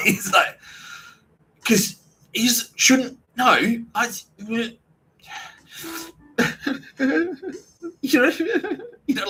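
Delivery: high, slow words broken by laughter; cracking up mid-sentence; laughing. Real, not acted. Teller cracks up retelling the brunch reaction, laughing.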